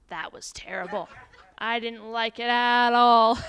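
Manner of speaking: a customer cracking a corny joke; joking dad customer. Acted.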